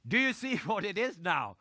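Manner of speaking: mock-exasperated repeat of the question; upset coach. Faldo pressing the coach, mock upset. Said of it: "he became upset because he says".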